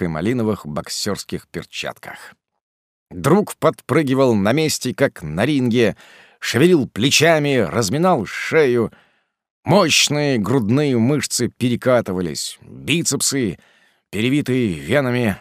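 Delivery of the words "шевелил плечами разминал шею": ordinary delivery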